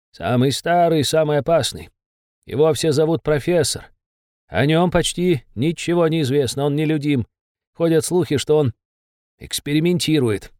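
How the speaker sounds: usual speaking voice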